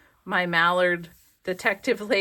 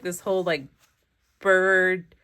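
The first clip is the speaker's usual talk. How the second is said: ordinary delivery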